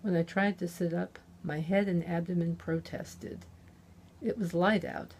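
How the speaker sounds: ordinary delivery, nothing marked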